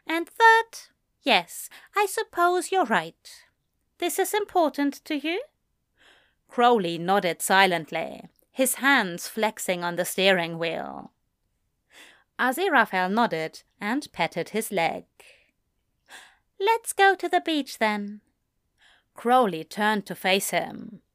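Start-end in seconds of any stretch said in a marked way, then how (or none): 0.1-5.4 high, fast, fussy angel voice; a prim, gentle angel, pitched up; Aziraphale voice. Acted. 16.6-18.2 high, fast, fussy angel voice; a prim, gentle angel, pitched up; Aziraphale voice. Acted.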